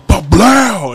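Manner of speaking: high-pitched vocal gunshot boom; imitated shotgun blast. Friend recreating the shot at the fleeing truck, imitated shotgun blast. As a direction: burst out a mock gunshot noise.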